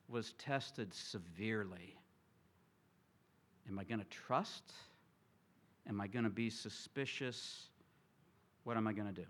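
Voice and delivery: quiet, low, searching questions; soft, uncertain self-questioning; hushed inner doubt. Retold or imitated. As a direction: voice the doubt under your breath. Pastor voices inner doubt quietly.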